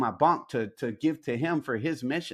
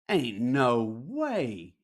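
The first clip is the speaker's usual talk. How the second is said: slow disbelief; much slower than usual. Retold or imitated. Inner thought, dawning realization.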